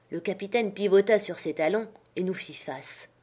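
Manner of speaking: ordinary delivery, nothing marked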